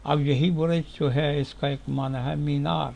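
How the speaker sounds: usual speaking voice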